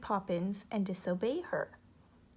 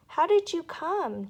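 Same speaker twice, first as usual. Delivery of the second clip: put-on higher, louder child's question; curious girl; 7 semitones higher, 8 dB louder than usual. A narrator's reading. Jane asking the new nanny, higher and eager. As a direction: ask like an eager little girl.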